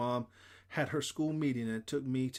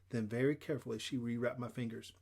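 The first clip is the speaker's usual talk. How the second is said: ordinary delivery, nothing marked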